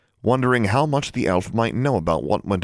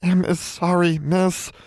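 high, apologetic elf; sorry but pitched-up elf; 10 semitones higher, slower than usual. A narrator's reading. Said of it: "Em said apologetically".

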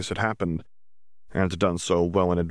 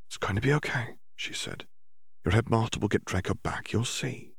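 reassuring; 3 semitones higher, 6 dB quieter than usual. Acted. Hermione comforting crying girl.

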